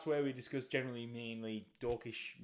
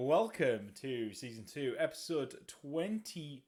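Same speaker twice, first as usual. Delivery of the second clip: loud, big show opening; announcer voice; 2 semitones higher, 6 dB louder than usual. Acted. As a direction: welcome the audience like an emcee. Podcast host opens the show.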